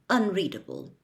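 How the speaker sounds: usual speaking voice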